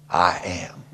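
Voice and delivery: hushed whisper as God. Acted. God reassuring the preacher, whispered. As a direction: breathe it quietly. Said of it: "he comes and tells me".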